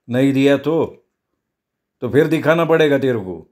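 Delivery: calm, controlled menace; cool threat. Acted. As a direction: threaten him evenly, without shouting. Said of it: "Luca अगदी थंडपणे बोलला". (थंडपणे धमकी)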